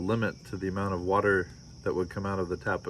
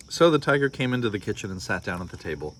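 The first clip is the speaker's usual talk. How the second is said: storybook read-aloud with voiced characters; story narration; 4 semitones higher, 4 dB louder than usual. A narrator's reading. Parent reading a picture book to listeners, lively storytelling. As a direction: read it like bedtime story time.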